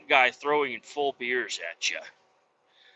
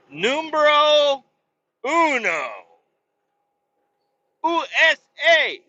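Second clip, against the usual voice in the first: hammed-up Spanish accent, much higher and louder, slow; mock Spanish accent; 12 semitones higher, 5 dB louder, slower than usual. Podcaster hamming for listeners, mock Spanish accent. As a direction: belt it like a cartoon Spanish announcer. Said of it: "I think I was going for Spanish".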